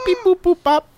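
high robotic beep boop noises; mouth beeps. Shown as a demonstration. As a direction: beep like an old computer. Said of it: "noises with my mouth".

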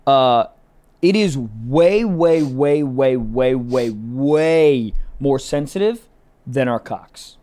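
stretched out, loud, slow way way way; drawn-out emphasis. Real, not acted. Pressing the point to the guys, slow and loud. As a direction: lean hard on every way. Stressing how much more sensitive.

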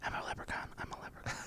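whispered to oneself; barely audible whispered self-coaching. Acted. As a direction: whisper it as a private warm-up. Muttered warm-up before the accent.

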